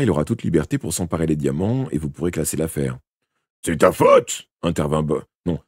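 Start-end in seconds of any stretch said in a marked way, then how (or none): 3.6-4.5 loud, high yelped accusation; accusing Boyle. Acted. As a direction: burst out with the blame, pitch leaping up. Boyle's accusation, first take.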